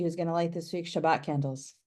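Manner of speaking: usual speaking voice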